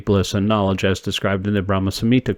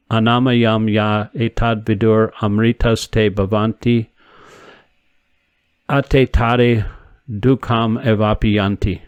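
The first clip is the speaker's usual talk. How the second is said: Sanskrit verse recitation; slower than usual. Acted. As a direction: intone the quoted verse with care. Quotes Sanskrit Upanishad verse.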